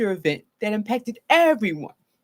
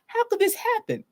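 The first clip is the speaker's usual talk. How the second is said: confused disbelief; 11 semitones higher than usual. Shown as a demonstration. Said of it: "a confusion of".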